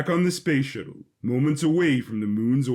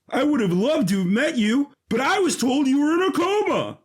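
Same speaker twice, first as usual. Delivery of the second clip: high, loud bitter outburst; jilted boyfriend confronting his ex; bitter outrage; 11 semitones higher, 3 dB louder than usual. Acted. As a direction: throw the lie back at them. Jesse snaps at ex's father.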